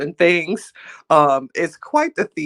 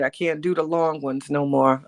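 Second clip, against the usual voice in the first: ordinary talk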